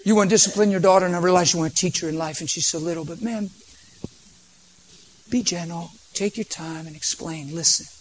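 calm the angry father down tenderly; gentle plea. Retold or imitated. Preacher pleads softly with the stern dad on the plane.

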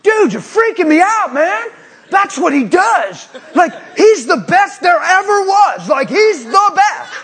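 high, loud, slow; the stunned guy shouting in disbelief; freaked-out guy. Retold or imitated. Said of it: "the other guy goes".

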